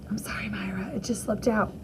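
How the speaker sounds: whispered, guilty apology; hushed sorry to a friend beside her. Acted. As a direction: breathe it out so no one else hears. Apologizing quietly to Myra.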